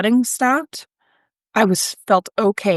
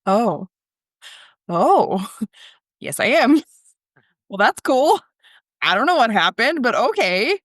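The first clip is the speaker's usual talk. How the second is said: laughing surprise; 2 semitones higher than usual. Retold or imitated. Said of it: "I was like".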